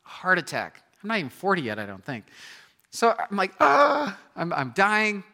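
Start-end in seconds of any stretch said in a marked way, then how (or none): 2.9-3.5 panicked. Retold or imitated. Panicked retelling of heart scare. 3.6-4.2 sharp high cry of chest pain, loud; loud high gasping cry of pain. Retold or imitated.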